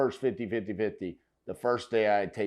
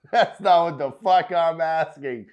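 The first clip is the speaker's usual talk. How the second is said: loud, amused repeat of her line; quoting the seller loud; 5 semitones higher, 6 dB louder than usual. Retold or imitated. Host relishing the seller's rude retort, loud and delighted. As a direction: echo the old lady's rude line with glee. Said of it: "She said".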